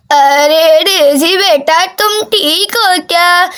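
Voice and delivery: put-on slow, drawn-out old granny; granny greeting her grandchild, slowly; doting grandmother. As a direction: stretch the words like a grandmother. Voices the visiting grandmother.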